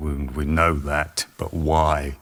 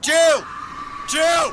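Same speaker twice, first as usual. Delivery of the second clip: loud, high, alarmed call; shouting a name; 4 dB louder than usual. Acted. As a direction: shout her name in panic. Calling out to a fallen woman.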